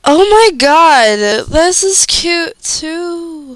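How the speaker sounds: squealing high over cute fan art, drawn out slowly; excited gush. Real, not acted. Fan seeing cute art, gushing with a high slow squeal. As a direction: coo over it like you just found a treasure.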